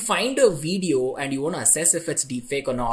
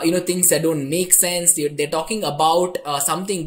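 ordinary delivery

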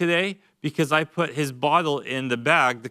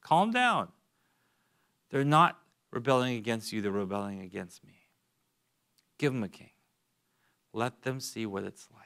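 calm reassuring God; 5 semitones lower, 6 dB quieter than usual. Acted. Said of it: "God said to Samuel's heart".

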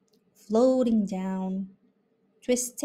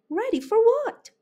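puzzled fawn; 13 semitones higher, 4 dB louder than usual. A narrator's reading. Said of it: "questioned the fawn".

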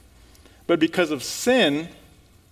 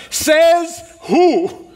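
high, loud, punchy "Says who?"; loud challenge; 9 semitones higher, 5 dB louder than usual. Acted. Preacher challenging his congregation, loud and defiant. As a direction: fling the question out at them.

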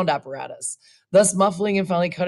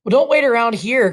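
higher, louder, savage jeer; savage cry; 5 semitones higher, 5 dB louder than usual. A narrator's reading. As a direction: lash out at her cruelly. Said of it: "cried the boy savagely".

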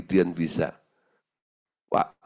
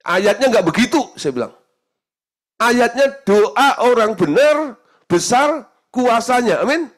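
loud, high, slow proclamation of the verse; preaching at full force; 14 semitones higher, 8 dB louder than usual. Acted. Preacher corrects a congregant with the verse, proclaiming. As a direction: drive the verse home slowly.

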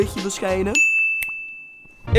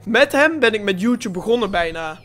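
usual speaking voice

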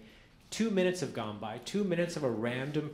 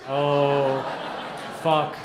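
loud outburst; 8 dB louder than usual. Retold or imitated. Buddy's envious reaction, sudden outburst.

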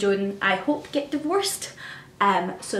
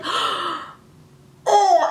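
gasp; 9 semitones higher than usual. Retold or imitated. Her gasp on seeing Sherlock's realisation.

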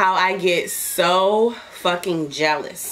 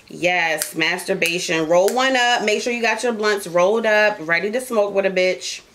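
ordinary delivery

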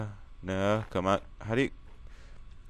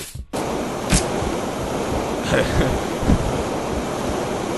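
long breathy evil cackle; drawn-out villain laugh; 5 semitones higher than usual. Acted. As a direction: gloat in a long wheezing laugh. Villain gloats over kill.

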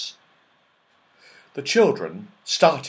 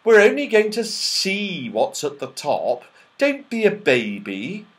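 impatient scolding; 9 semitones higher, 7 dB louder than usual. Acted. Joe chiding his sister, loud impatient. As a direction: chide her for being a baby.

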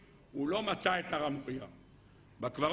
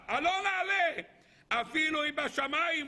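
rallying call; 10 semitones higher, slower than usual. Acted. Caleb calling to the people to go up, high rallying cry. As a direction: rouse the crowd to go up.

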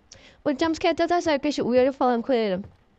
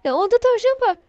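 yüksek ve yüksek sesli korku çığlığı; tavşanı görünce korkuyla bağır; korkuyla bağırış; 10 semitones higher, 5 dB louder than usual. Real, not acted. Kapıda tavşanı görüp korkuyor. (kapıda tavşanı gören oyuncu, korku çığlığı)

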